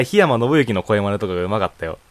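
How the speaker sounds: ordinary delivery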